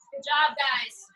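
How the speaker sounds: normal talking voice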